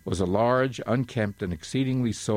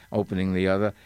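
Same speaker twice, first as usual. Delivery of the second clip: usual speaking voice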